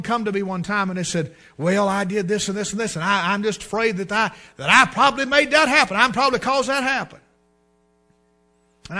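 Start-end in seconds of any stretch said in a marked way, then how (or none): 1.6-7.2 anxious confession. Retold or imitated. Preacher voices anxious congregant.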